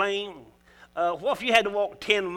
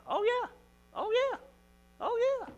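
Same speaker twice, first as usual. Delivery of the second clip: high exclaim; 6 dB quieter than usual. Real, not acted. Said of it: "preacher exclaims affirmation".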